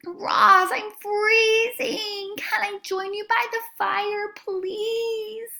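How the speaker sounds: high, slow, pleading small creature; weak squirrel voice. Acted. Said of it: "said a weak voice".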